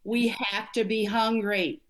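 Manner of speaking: slow, drawn-out motivational speaker voice; Les Brown. Acted. Coach quoting a motivational speaker to her host, impersonated slowly. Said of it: "I'll try my innermost Les Brown voice".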